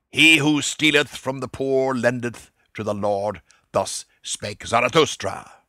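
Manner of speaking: solemn mock sermon. A narrator's reading. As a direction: intone it like a mock priest. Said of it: "said solemnly".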